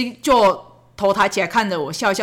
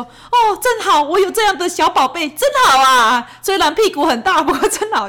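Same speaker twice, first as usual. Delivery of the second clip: much higher, louder doting coo; cooing delight; 7 semitones higher, 4 dB louder than usual. Retold or imitated. Mother pressing her face to her son's back, doting high coo. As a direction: coo at your chubby little treasure.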